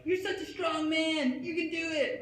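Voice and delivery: squealed high falsetto cheerleading; gushing, drawn-out falsetto pep talk. Acted. Imagined pop star cheering him on in his head, gushing falsetto. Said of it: "She's always like".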